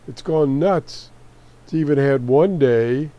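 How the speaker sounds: ordinary talk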